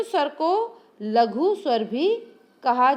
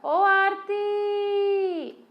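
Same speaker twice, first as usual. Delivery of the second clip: calling out long; 7 semitones higher, much slower than usual. Shown as a demonstration. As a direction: call across a distance, stretching the ओ. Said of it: "लंबा राग ले रहे हैं". (दूर से पुकारते हुए लंबा "ओ राम")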